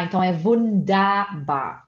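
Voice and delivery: ordinary talk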